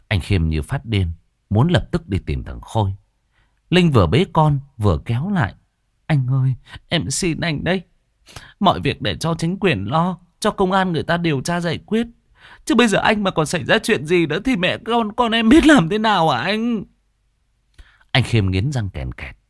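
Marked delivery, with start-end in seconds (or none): 6.1-16.8 high, rising desperate plea; tearful pleading. Acted. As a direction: beg him to stay, near tears. Wife pleading with husband. (người vợ van xin chồng trong nước mắt)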